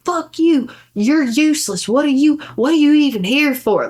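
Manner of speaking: husband yelling at wife in laundromat, mock anger; mock angry husband. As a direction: tear into her like an angry husband. Husband escalating the argument.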